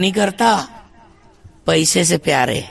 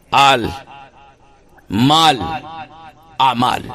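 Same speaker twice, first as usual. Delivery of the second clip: آل، مال، اعمال each said apart with long pauses; listing slowly; 5 semitones lower, slower than usual. Shown as a demonstration. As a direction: count out آل، مال، اعمال one by one. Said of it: "کہ انسان کے دوست ہوتے ہیں". (ہر لفظ الگ ٹھہر کر)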